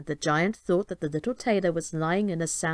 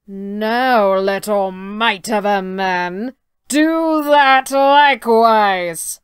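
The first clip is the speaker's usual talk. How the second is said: much louder, slow and heavy; slow booming giant challenge; 9 dB louder, much slower than usual. Acted. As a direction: boom it out like a proud giant.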